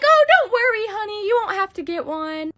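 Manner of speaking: high, sugary imitation of mom; sweet mom voice. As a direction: coo it like a soothing mother. Mom reassuring daughter in story.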